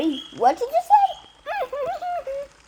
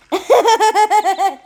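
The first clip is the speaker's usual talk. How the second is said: evil laugh; 6 semitones higher, 8 dB louder than usual. Acted. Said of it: "let's see your evil laugh".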